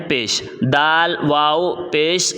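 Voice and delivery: پیش، دال، واو، پیش named slowly one by one; name each sign slowly; slow spelling out. Shown as a demonstration. Teacher spelling out letters and vowel signs, very slowly. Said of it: "کھینچ کر پڑھنا ہے".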